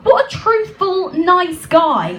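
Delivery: high, sarcastic sigh of admiration; mock euphoric. Heartbroken woman mock-praising her cheating lover. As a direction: fake rapture over his honesty. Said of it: "euphoric moment".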